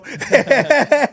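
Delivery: loud high burst of laughter; real laugh. Real, not acted. Joking with the co-host, loud high laughter.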